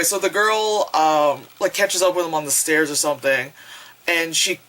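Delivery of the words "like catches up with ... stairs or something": normal talking voice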